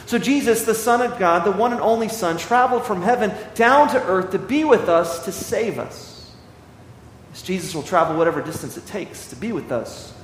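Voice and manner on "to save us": slow and much softer, letting it land; softened emphasis. Real, not acted. Preacher telling the congregation softly and slowly. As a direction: drop the voice to almost nothing on the rescue.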